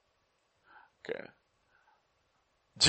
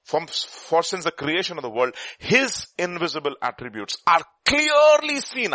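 high, loud solemn reading; scripture reading; 6 semitones higher, 5 dB louder than usual. Acted. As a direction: proclaim the verse. Reads verse twenty aloud.

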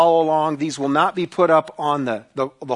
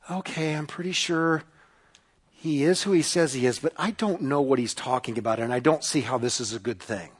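soft, lowered, uneasy musing; quietly doubtful; 3 semitones lower, 8 dB quieter than usual. Acted. Peter privately doubts what Jesus teaches, quietly doubtful. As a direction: think it through under your breath.

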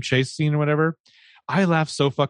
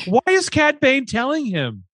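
exasperated; 11 semitones higher than usual. Real, not acted. Exasperated complaint.

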